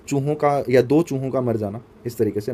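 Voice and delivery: ordinary talk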